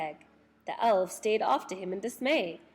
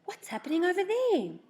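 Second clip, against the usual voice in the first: surprised; 10 semitones higher than usual. Acted. Surprised child at strange sight.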